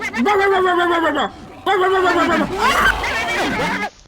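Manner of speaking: loud shrieking laugh; hysterical laughter. Real, not acted. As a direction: lose it laughing. Escape from the dog.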